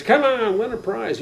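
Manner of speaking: high, called-out midway pitch; mock barker luring players; carnival barker. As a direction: holler like a fairground game caller. Imitating carnival game barker.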